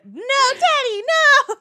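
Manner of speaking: high, loud wail to Daddy; hammed-up horror-movie daughter cry; imitating Megan's yelling. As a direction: yell it in a very high, pleading daughter voice. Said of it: "Megan yelling at her dad".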